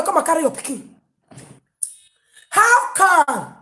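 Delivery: ordinary talk, nothing marked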